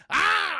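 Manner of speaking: a high mock battle yell; a retold fighting shout, pitched high; retold attack yell. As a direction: let out a sudden high slashing cry. Steve slashes the man's throat.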